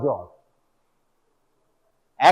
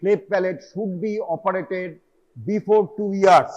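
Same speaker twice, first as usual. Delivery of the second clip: dictated rule; much slower than usual. Retold or imitated. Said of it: "বলা হচ্ছে".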